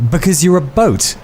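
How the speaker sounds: high-pitched shout, held back in volume. A narrator's reading. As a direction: blurt it out as a restrained shout. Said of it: "he shouted. He really shouted".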